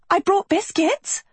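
very high, sing-out offer; high-pitched bait for a departing friend; enticing call. Acted. Calling to lure friend back.

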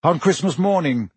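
ordinary delivery

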